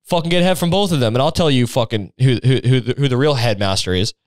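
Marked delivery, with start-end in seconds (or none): 0.0-1.1 high, pushy mock confrontation; brash challenge. Student dares the headmaster to prove himself, brashly mocking. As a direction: goad him with cocky, comic insistence.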